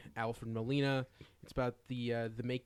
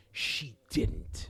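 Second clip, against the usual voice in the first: soap opera gasp; 5 semitones lower than usual. Retold or imitated. Mimicking soap opera reaction.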